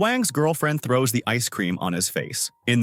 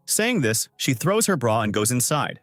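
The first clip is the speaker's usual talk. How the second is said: ordinary delivery, nothing marked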